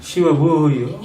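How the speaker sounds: ordinary talk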